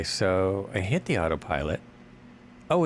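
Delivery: ordinary talk, nothing marked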